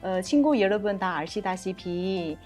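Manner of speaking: Korean with a Chinese accent. Real, not acted. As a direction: native Chinese speaker talking Korean. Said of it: "제가 중국 사람이라서 한국말 좀 서툴렀는데".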